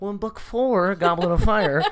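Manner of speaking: high, loud cracking insistence; strained shouty fan insisting; mock insistent. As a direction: plead it almost crying. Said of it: "And I go".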